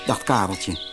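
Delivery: plain reading voice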